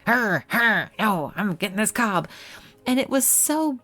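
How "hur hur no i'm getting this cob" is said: lightly voiced cat growling back; the cat refusing to give up the cob; voiced stubborn cat. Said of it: "And he".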